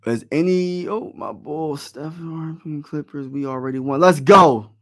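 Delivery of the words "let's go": shouted celebration; loud cheer. Real, not acted. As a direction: yell it out in triumph. Celebrating a win with viewer.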